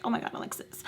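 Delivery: soft whispered therapist; hushed aside. Retold or imitated. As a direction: breathe it softly.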